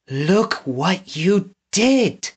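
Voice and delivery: a lone voice in the darkness; forlorn lament. A narrator's reading. Prisoner mourns alone in the dark cavern, slow and forlorn.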